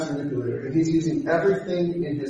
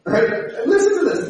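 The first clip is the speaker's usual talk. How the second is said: ordinary delivery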